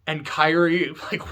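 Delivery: ordinary delivery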